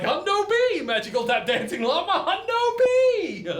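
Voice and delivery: high, stretched squeal of delight; excited squeal. Retold or imitated. Storyteller accepting the llama's party invite, squealing high. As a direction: shriek it out like a thrilled partygoer. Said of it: "And I'm like".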